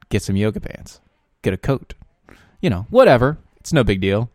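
loud upbeat broadcast announcer; radio host voice. Acted. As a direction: project it like live radio. Show host radio intro.

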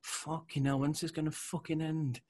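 mock exasperation. Acting out being mid-workout for co-host, exasperated. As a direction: plead for it to be over.

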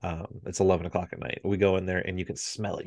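normal talking voice

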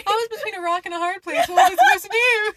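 hammed-up victim protesting his innocence; mock-wounded [name]. [name] whining to his friends about hitting the walls, in hammed-up mock distress.

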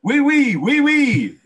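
high squeaky mock French oui oui. Mocking Harry's French.

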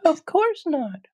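put-on very high, squeaky girl voice; little girl. Dorothy answers the witch's accusation, high girlish protest.

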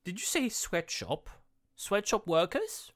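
high, questioning pretend surprise; mock mishearing. Playing a company answering an objector, mock mishearing. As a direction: pitch it up as a puzzled query, slowing on the repeat.

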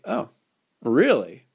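a knowing, sly "really"; teasing, playful disbelief; sly grin. Shown as a demonstration. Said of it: "say it with sort of a sly grin".

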